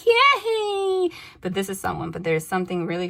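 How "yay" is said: high, loud squeal of glee; hammed-up fairy-tale delight; mock cheer. Mimics fairy-tale cheer she denies.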